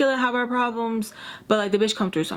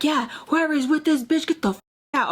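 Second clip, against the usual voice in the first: higher-pitched angry dad, retold; mock mad; 6 semitones higher than usual. Dad throwing her out of the party, mock mad. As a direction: order them out, hammed-up. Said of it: "he was so mad".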